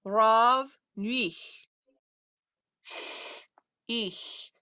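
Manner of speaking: slow "Rav, nuich" in two separate parts; pronunciation demo. Shown as a demonstration. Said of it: "would you pronounce again R A V N I H".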